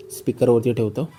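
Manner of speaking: normal talking voice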